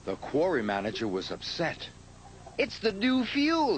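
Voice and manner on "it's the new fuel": much higher, louder alarmed cry; cried out. A narrator's reading. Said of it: "he cried".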